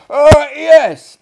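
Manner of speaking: high, loud answer over noise; shouted reply. Acted. Host shouting back his answer over the party noise. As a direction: bellow back over the din.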